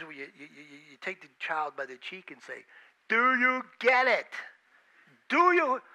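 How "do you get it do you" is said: louder and higher, insistent parent; pressing parent. Retold or imitated. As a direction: drill it into the child. Parent pressing child by the cheek.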